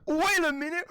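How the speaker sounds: high-pitched mock-British surprise; imitated British guy. Retelling the British guy meme, high mock-British voice. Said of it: "it's a British guy".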